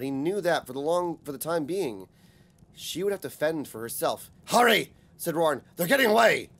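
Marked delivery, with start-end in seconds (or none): 4.5-4.9 loud, high urgent call; urgent shout. A narrator's reading. Urgent chase, Roran urging. 5.8-6.5 loud, high urgent call; urgent shout. A narrator's reading. Urgent chase, Roran urging.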